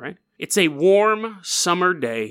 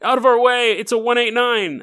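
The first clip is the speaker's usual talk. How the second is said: mock cop yell; 8 semitones higher, 3 dB louder than usual. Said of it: "cops racing through streets".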